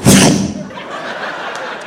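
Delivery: unvoiced whoosh of fleeing fish; fish darting away. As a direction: imitate a sudden swish with breath alone. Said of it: "all the little pretty fish go".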